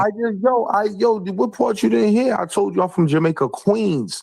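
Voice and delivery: high-pitched, insistent correction; frustrated and pitched well up; exasperated. Real, not acted. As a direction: push back, as if not being heard. Frustrated at not being heard.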